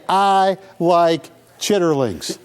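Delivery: slow, deliberate I like chitterlings; say it slowly. Shown as a demonstration. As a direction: hand them the exact line, slowly. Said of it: "but you gotta say".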